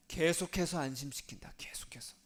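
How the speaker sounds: hushed whisper, fading away; reassuring whisper. Real, not acted. Preacher reassuring congregation of continual comfort, hushed whisper. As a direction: whisper it, letting the repeat die off.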